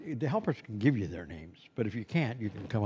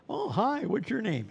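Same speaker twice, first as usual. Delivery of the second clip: much higher, cheery hello; friendly Santa greeting; 11 semitones higher, 3 dB louder than usual. Retold or imitated. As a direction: greet the child with a bright, high lift. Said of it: "A child comes up to you and you say".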